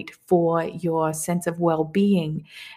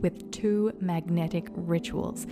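softer, upbeat promo voice; ad read; 8 dB quieter than usual. Acted. Teacher promotes her moon course, soft ad read. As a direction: pitch the course like a radio spot.

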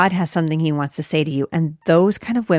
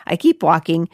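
ordinary talk

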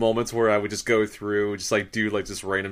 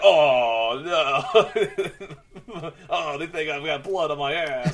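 mock shocked man; 5 semitones higher, 6 dB louder, much slower than usual. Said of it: "And he's like".

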